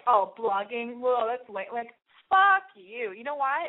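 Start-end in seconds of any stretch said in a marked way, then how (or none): 0.1-1.7 mocking scoffer. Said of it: "when people are like". 1.7-3.1 defiant. Real, not acted. Defiant outburst at critics.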